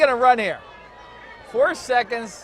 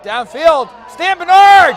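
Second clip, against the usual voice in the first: excited play call; 6 semitones higher, 9 dB louder, slower than usual. Real, not acted. Live call of a long pass.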